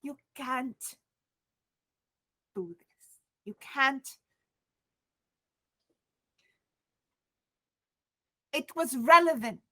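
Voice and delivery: halting, broken pleading; stumbling over words, rattled; shaken. Acted. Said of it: "she's losing it".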